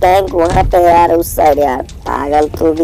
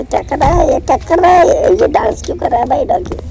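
amazed high pitch; 12 semitones higher than usual. Real, not acted. Dog suddenly dancing, amazed.

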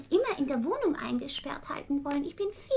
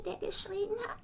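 whisper; 3 semitones higher, 6 dB quieter than usual. Acted. Cat confides secret softly.

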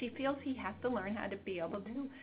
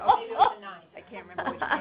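mock puzzlement; 3 semitones higher, 9 dB louder, faster than usual. Therapist's mock confusion to the kid.

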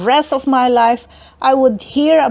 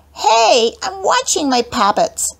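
put-on higher, childish protest; little sister voice; 8 semitones higher than usual. A narrator's reading. Little sister objecting to her brother. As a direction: whine like a little girl. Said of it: "Abby said".

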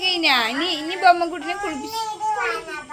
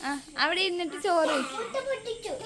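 ordinary talk

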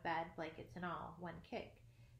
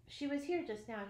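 slightly raised, mildly impatient voice; a touch of impatience, higher at first; a little impatient; 5 semitones higher, 4 dB louder than usual. A narrator's reading. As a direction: say it with mild impatience. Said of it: "he said, a little impatiently".

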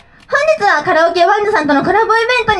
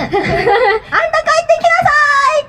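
lightly voiced mother calling a child in; mock scolding mother; 9 semitones higher, much faster than usual. 遊んでいる子供をお母さんが呼び戻す、軽い叱り声の真似. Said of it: "お母さんに怒られちゃう".

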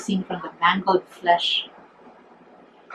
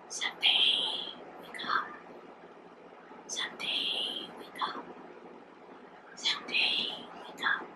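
sibilant sighing; 16 dB quieter than usual. A narrator's reading. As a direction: whisper it like the sea shushing. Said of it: "shushing the sea's sibilant sighing".

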